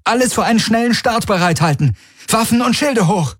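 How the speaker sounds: high-pitched battle commands; urgent called orders. A narrator's reading. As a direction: call orders into the comm, voice high. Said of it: "rief Kirai in ihr Kommen". (hoch gerufene, dringende Befehle)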